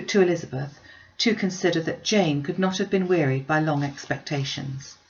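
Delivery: plain reading voice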